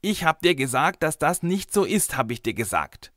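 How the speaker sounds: put-on high-pitched stroppy little girl; sulky insistence. A narrator's reading. Girl scolds the boy in their quarrel, stubborn. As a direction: insist you were right, stubborn and high. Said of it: "rief Mareike bockig".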